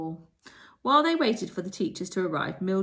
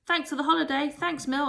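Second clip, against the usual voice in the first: cheering; 9 semitones higher, 4 dB louder than usual. A narrator's reading. Girls cheering Mildred.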